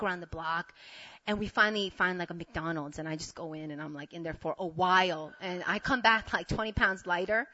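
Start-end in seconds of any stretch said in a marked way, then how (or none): none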